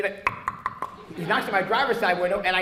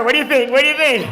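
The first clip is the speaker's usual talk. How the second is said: put-on showing off to girlfriend; 5 semitones higher, 9 dB louder than usual. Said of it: "He went like this to her".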